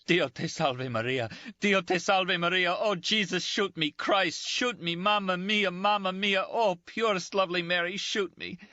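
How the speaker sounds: high, frantic pleading prayer; praying in agony, voice climbing; desperate prayer. Acted. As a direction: beg heaven to end the pain. Dying man praying, begging to die.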